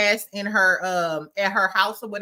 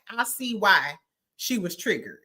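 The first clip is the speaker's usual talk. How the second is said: usual speaking voice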